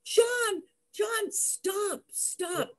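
high pleading call, fading off; scared plea. Retold or imitated. Girlfriend begging speeding boyfriend to stop, scared. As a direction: beg him to stop, growing fainter. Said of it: "And I'm saying".

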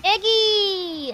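sing-out call, falling at the end; playful drawn-out call. Shown as a demonstration. Showing Korean word, playful call.

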